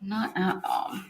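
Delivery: soft, voiceless whisper; hushed aside, barely voiced; whispered echo. Real, not acted. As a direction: repeat it under your breath. Echoes own answer under breath.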